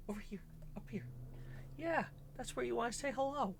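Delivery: very high coaxing voice; pet talk. Real, not acted. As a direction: coax the pet up, high and bright.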